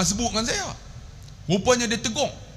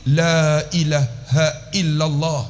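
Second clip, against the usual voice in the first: "la ilaha illallah" recited slowly, long "la"; drawn-out zikir; much slower than usual. Shown as a demonstration. Preacher teaching the best zikir, "la ilaha illallah" slow with long "la". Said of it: "la panjang".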